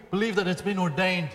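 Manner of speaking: ordinary talk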